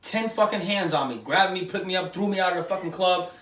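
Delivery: usual speaking voice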